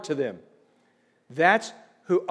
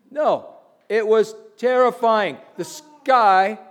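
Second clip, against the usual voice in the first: normal talking voice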